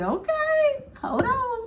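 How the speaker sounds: high, drawn out doubtful okay; a suspicious wait a minute; skeptical okay. Retold or imitated. Skeptical friend eyeing guy.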